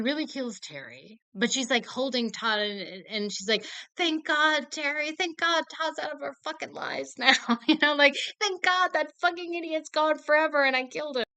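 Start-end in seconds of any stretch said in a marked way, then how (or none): 4.0-11.2 high, loud, unhinged relief; relieved high voice. Retold or imitated. Mother holding her son, thanking God in shrill relief. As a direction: cheer wildly over the killing.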